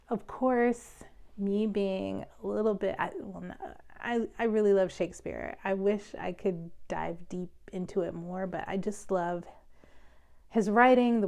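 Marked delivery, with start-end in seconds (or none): none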